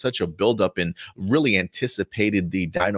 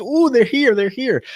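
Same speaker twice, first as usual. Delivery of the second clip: high, giddy mock squeal of delight; imitated pure excitement; 14 semitones higher, 5 dB louder than usual. Nate seeing his parents arrive, imitated giddy excitement. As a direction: mimic an overexcited kid, starting high and loud. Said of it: "like, just pure excitement".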